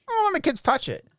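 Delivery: high, prissy mocking parent voice; mock prim parent. Husband mocking the cautious parent, high and prissy. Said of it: "who the fuck looks at this turtle and goes".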